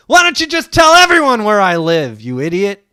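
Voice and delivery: loud, high exasperated yell through laughter; real laughing shout; laughing outrage. Real, not acted. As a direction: yell at him for giving out the address. Friend yells at doxxing joke.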